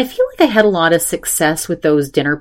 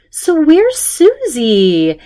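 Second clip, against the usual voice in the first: slow, high, louder puzzled question; imitating Andrea; 10 semitones higher, 4 dB louder, much slower than usual. Andrea asking the husband where Susie is, slow high puzzled. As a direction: draw it out, bewildered.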